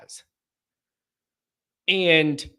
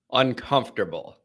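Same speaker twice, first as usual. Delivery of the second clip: ordinary talk, nothing marked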